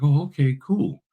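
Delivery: high, mock-impressed "oh okay, cool"; impressed reaction. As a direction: pitch up like a listener caught off guard. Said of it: "It's like".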